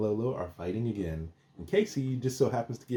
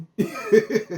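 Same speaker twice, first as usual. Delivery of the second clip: a loud, high burst of laughter; 10 semitones higher, 8 dB louder than usual. Real, not acted. Cracking up at the show, loud and high.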